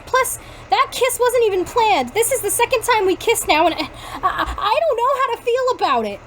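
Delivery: high-pitched, flustered confession; lightly hammed-up upset, stumbling on "I"; acted distress. A narrator's reading. Girl confessing to a second kiss, flustered and upset.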